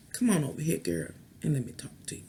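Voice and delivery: low, coaxing invitation, trailing to a whisper; seductive come-on. Pretend. South Pole luring the North Pole, sultry and low. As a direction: beckon her over in a sultry hush. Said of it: "So it's like".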